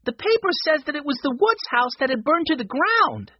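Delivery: put-on high, urgent child's voice; breathless-news excitement in a boy's voice; excited boy. A narrator's reading. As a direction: blurt out the shocking news, high-pitched. Boy yelling out shocking newspaper news.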